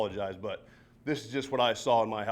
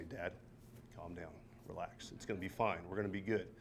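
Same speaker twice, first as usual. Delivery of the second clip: soothing daughter; 5 semitones lower, 12 dB quieter than usual. Retold or imitated. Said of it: "This is her talking to me like this".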